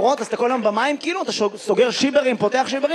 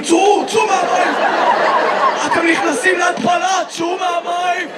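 a mock lifeguard bellow at bathers; lifeguard yelling; 5 semitones higher than usual. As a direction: order the swimmers out of the water. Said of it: "צעקת על ראשנים".

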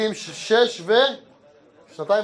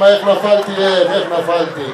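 mock lament; 10 dB louder, slower than usual. Men on mall sofas lamenting.

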